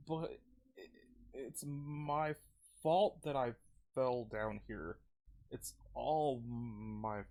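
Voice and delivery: slow, broken, defeated voice; guilt-ridden character. Acted. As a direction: drag out each phrase, fading with guilt.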